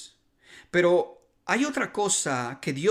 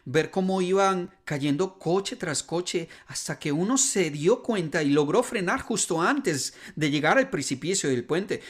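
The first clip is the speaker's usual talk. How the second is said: usual speaking voice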